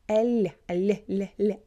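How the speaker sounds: isolated palatal L sounds, "ль"; soft L. Shown as a demonstration. Teacher drills the Russian sound, soft L. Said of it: "L is soft".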